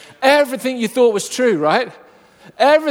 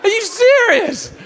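incredulous drive-through voice; slower than usual. Retold or imitated. Drive-through worker querying the second order, incredulous. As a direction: shriek it back as an outraged question. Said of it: "I kid you not".

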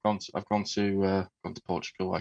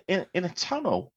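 retold answer; 10 semitones higher than usual. Retells own answer to colleague.

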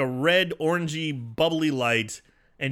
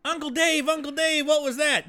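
put-on much higher, nervous boy voice; scared kid voice; 13 semitones higher than usual. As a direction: squeak it like a spooked kid. Kids voiced in retold scene.

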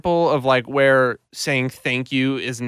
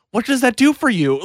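baffled question; 8 semitones higher, 4 dB louder than usual. Retold or imitated. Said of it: "And I'm like".

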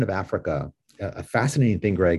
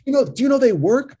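high, loud, eager Staten Island voice; a pumped-up Staten Islander selling it hard; Staten Island accent; 6 dB louder than usual. Retold or imitated. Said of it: "he had that heavy accent".

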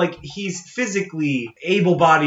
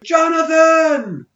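high, loud shouted name; imitated yell; 14 semitones higher, 7 dB louder, much slower than usual. Correcting the co-host's imitation of Miles, high yell. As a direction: shout the name, stretched then falling away. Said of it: "He goes like".

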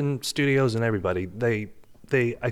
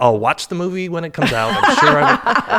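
high, loud, overly polite goodbye; mock cheery sign-off, laughing at the end; awkward polite exit; 6 semitones higher, 6 dB louder than usual. Actor leaving an audition, awkwardly over-polite.

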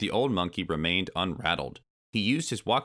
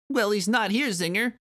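very high, louder little creature voice; small Kremling; 4 dB louder than usual. Acted. Small Kremling answering the giant bee, high creature voice. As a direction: squeak it out as a tiny henchman.

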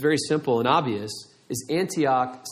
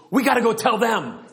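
over-the-top eager convert, higher pitch; super excited; 7 semitones higher, 4 dB louder than usual. Retold or imitated. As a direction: imitate a new believer bursting to share. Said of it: "He was super excited, a little over the top".

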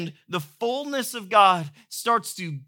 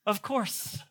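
softer, smug tossed-off repeat; smug aside; 8 dB quieter than usual. Shown as a demonstration. Pastor bragging about his workout, smug soft aside. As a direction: savour it quietly to yourself.

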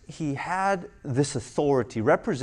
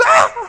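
imitating Jenny's loud frightened shriek; a high loud startled ah; mock horror-movie scream; scared scream; 11 dB louder than usual. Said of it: "she's like".

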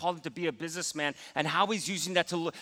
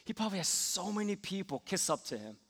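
inner grumbling; 6 dB quieter than usual. Retold or imitated. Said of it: "I started to think".

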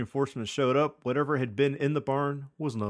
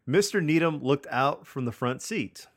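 normal talking voice

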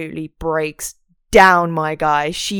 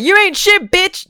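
mock insult; 11 semitones higher, 4 dB louder than usual. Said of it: "she doesn't say that".

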